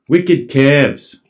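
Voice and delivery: "Wicked Cavs" in a Boston accent, r dropped, "Cavs" for curves; Boston "Wicked Cavs", no r, broad a. Shown as a demonstration. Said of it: "my Boston accent when I do Wicked Cavs".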